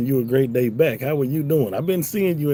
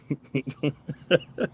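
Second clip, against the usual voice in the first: chuckle; 4 semitones higher, 5 dB quieter than usual. Real, not acted. Streamer amused after teasing about trad wives, chuckling. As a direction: chuckle at your own joke. Laughs at his own question.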